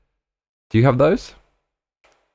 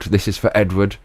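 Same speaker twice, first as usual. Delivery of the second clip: usual speaking voice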